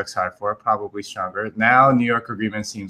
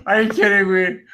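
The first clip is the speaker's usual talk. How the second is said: laughing disbelief; 9 semitones higher than usual. Real, not acted. Laughing at friend's bad accent.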